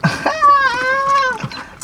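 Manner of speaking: high falsetto laugh. Real, not acted. Laughing at the hot sauce remark.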